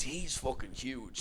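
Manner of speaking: quiet, slow aside; muttered aside. Retold or imitated. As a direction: say it under your breath, slowly. Inner thought said aside.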